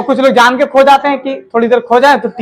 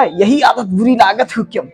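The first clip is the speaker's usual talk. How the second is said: sneering gossip; 4 dB quieter than usual. Retold or imitated. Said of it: "जानो क्या कह रहा था".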